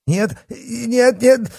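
high-pitched panicked fat man; terrified pleading. A narrator's reading. Terrified fat man begs for life.

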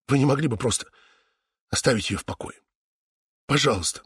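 soft, trembling plea; a quiet voice about to break. A narrator's reading. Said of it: "Голос Фионы задрожал". (дрожащим голосом)

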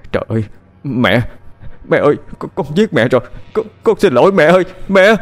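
stammering panic, climbing high and loud; horrified scream. A narrator's reading. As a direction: break into a distraught cry for mother. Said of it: "nó hét lên". (hét lên hoảng loạn, lắp bắp)

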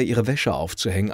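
ordinary delivery

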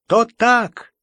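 high, loud cry of agreement; exclaiming. A narrator's reading. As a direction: shout it out eagerly. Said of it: "вскричал".

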